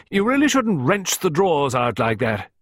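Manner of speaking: high, loud reproof; hearty scolding. A narrator's reading. Visitor telling man off over drawers, hearty scolding. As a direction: scold in a big carrying voice.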